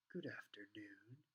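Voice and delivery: slow, soft, near-whispered greeting; smooth Snape. A narrator's reading. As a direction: draw it out in a silky hush. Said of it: "he said smoothly".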